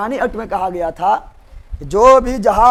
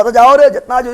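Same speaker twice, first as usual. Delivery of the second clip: loud, rousing mock command; acted rallying order; 2 semitones higher, 8 dB louder than usual. Samuel Adams sends his men at the tea ship, loud order. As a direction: call it out like a ringleader.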